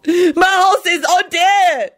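very slow, high grieving wail; sobbing. A narrator's reading. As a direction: drag it out in a sob.

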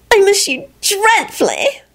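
put-on very high, drawn-out girlfriend voice; gushing lover. A narrator's reading. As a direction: pine slowly in a high, adoring voice. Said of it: "Solange sounded so thrilled".

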